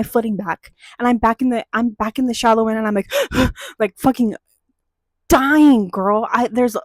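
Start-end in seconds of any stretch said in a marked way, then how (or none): 3.2-3.7 a sharp, high gasp for breath; gasp for air. Retold or imitated. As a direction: gulp air like you just came up from drowning. Surfacing after nearly drowning.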